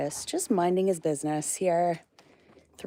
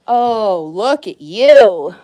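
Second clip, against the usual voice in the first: much louder, slow drawn-out "look at you"; loud cooing exclamation; 3 semitones higher, 20 dB louder, much slower than usual. Real, not acted. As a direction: gush at the animal, stretched and loud. Greeting a messy animal.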